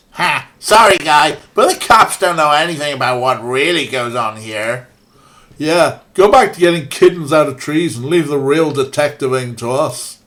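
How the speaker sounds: put-on loud, cocky Midwest accent; hammed-up Midwest accent. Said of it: "my best approximation of some sort of Midwest accent".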